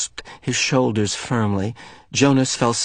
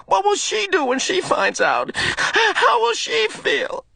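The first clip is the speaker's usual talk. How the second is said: high, shaken, pleading questions; reeling from the truth; boy horrified, pleading for answers; distraught. Acted.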